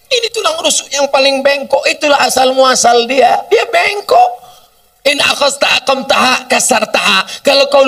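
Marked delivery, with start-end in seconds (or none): 3.5-4.3 sharply higher, near a wail; high emphatic cry. Real, not acted. Preacher crying out that woman is crooked, high.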